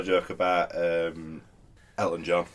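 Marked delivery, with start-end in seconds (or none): none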